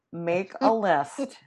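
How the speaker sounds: slow, loud bossy teacher; stretched-out classroom command. Acted. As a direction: lay it down like a teacher giving an assignment. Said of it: "I'm turning into teacher here".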